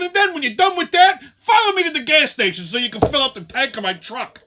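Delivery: loud, high demanding rant; angry rant. Retold or imitated. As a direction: order her around in fury. Ranting at the woman in line.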